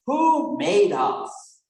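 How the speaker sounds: slow, high, loud question with stress on who; loud drawn-out quiz question; emphatic question. Shown as a demonstration. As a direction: draw out the question for a class. Catechism question repeated emphatically.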